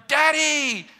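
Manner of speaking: put-on high, loud, childlike call; yelling. Us running to our Heavenly Father in prayer, yelling. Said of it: "Prayer is us yelling".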